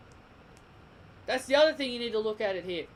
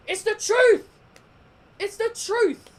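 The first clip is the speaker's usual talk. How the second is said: much louder, much higher yell; shouting insistence; 11 semitones higher, 9 dB louder, slower than usual. Real, not acted. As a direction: shout it as the last word. Heated rant defending opinion.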